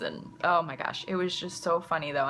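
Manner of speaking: ordinary talk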